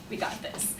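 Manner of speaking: very soft, voiceless aside; hushed whispered confidence; conspiratorial whisper. Retold or imitated. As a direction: whisper it like a secret plan between friends. Friends' secret confident aside, retold.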